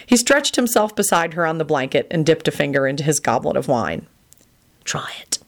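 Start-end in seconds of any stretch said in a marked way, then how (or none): 4.8-5.4 hushed, whispered hoarse demand. A narrator's reading. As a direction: rasp it out barely above a whisper. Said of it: "he demanded hoarsely".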